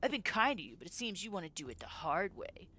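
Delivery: a hint of a leering thug, threatening; menacing thug. Thug threatening cornered Ella, menacing.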